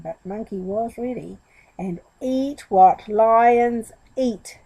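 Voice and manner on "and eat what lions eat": very slow and a little louder; feed him the last line slowly; prompting. Acted. Monkey prompting the forgetful goat, very slow. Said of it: "he prompted".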